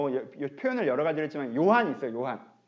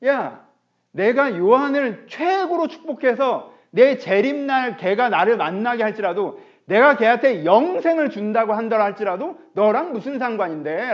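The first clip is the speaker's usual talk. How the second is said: irritated, louder voice, words pushed out slowly; annoyed Jesus; 5 semitones higher, 8 dB louder, slower than usual. Retold or imitated. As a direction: play Jesus fed up with Peter's question. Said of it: "예수님께서 짜증".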